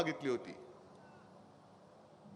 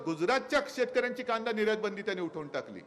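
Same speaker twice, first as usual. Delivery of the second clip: usual speaking voice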